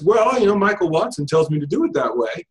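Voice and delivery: mock student excuse; student justifying themselves. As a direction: offer a weak excuse as a student, starting high. Voicing a student's weak justification.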